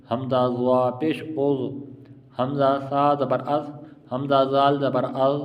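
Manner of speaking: slow chant, سا and زال thin, ضا thick; measured, level teaching drill, ضا full-mouthed; spelling drill. Shown as a demonstration. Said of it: "سا، زال باریک اور ضا موٹا".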